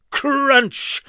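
very high, loud crunch; crunch sound effect. A narrator's reading. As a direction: crack it out loudly. Chair breaking sound effect.

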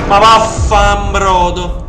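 loud drawn-out startled curse; italian angry cursing. Real, not acted. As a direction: yell the curse slowly. Jumpscare in horror game.

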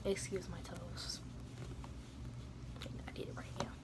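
soft unvoiced whisper throughout; whispered vlog. Real, not acted. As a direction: keep it hushed as if someone sleeps nearby. Keeps voice down while dressing.

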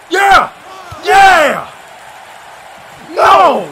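loud, high one-word outbursts; excited shouting. Real, not acted. Fan reacting to Babyface coming for Billy, excited shouting. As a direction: yell each reaction at full voice. Cheering, then dismay at match.

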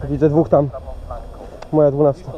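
loud callout to teammates; calling out. Real, not acted. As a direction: shout the enemy position across the field. Teammate calls out enemy positions.

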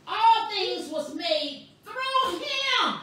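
slow, high proclamation; full-force preaching. Real, not acted. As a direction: stretch each word and land hard on "him".

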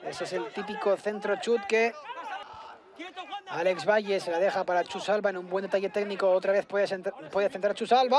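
usual speaking voice

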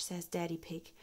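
ordinary delivery

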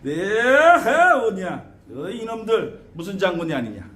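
hugely drawn-out rising call, then settling; shaman invocation. Shown as a demonstration. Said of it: "그러니까 어떻게 돼?".

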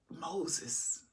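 whispered name; hushed whisper of Moses. Real, not acted. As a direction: breathe the name unvoiced.

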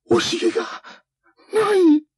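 very high, slow, crying; tearful panic. Acted. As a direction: stammer it near tears. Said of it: "女が叫んだ".

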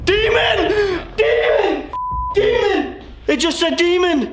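high-pitched, repeated cry of alarm; alarmed shout. Real, not acted. Investigator warns the others of a demon, high shout. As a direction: call out a warning, pitch leaping up.

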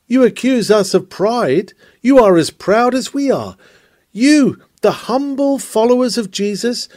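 high-pitched scornful accusation; accusing worldling. Acted. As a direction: throw the charge back at the church with pointed emphasis. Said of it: "What then do worldlings say".

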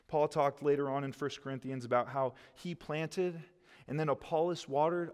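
ordinary talk, nothing marked

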